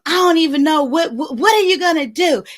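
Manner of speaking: retold scolding that keeps on going; fussing. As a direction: imitate a mother tearing into her kid. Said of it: "she's just going and going".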